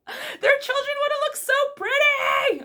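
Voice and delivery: very high, loud fangirl shriek; high-pitched overexcited wail; gushing squeal. Real, not acted. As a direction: squeal it as if overcome by cuteness. Gushing over her favourite couple.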